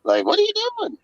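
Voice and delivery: high squeaky mimic of players questioning the play call; mock confused players. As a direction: squeal it like a clueless teammate. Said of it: "players would be like".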